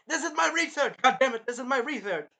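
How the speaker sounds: high, loud, indignant yelling; mock angry shout. Retelling the movie argument over the room, mock angry shout. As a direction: imitate someone shouting in outrage. Acts out character's angry outburst.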